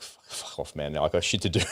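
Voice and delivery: whispered, can't-be-bothered brush-off; dismissive mutter. Retold or imitated. Mimicking South Koreans ignoring the drill, dismissive whispered mutter. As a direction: wave it off under your breath.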